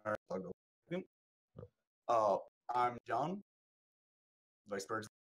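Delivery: choppy dropping call audio; buzzy glitchy call audio. Real, not acted. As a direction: voice breaking up over a bad connection. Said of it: "You're coming in and out real quick".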